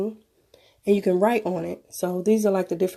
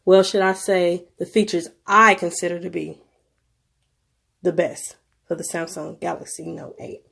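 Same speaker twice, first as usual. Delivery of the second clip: ordinary talk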